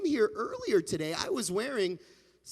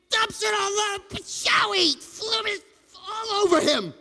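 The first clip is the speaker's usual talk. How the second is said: excited shout; 11 semitones higher, 5 dB louder than usual. A narrator's reading. Rabbi dumps spaghetti on himself.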